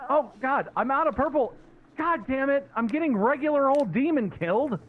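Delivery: high-pitched exasperated wail; distressed. Real, not acted. Telling teammates the demon is killing them, distressed. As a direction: whine it high in dismay. Out of purple, being killed.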